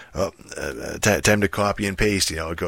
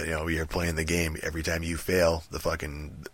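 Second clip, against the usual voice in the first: ordinary talk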